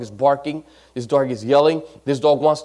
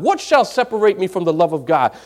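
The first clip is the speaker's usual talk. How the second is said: normal talking voice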